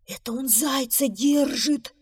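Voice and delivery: horrified whisper. A narrator's reading. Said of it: "в ужасе прошептал бобр".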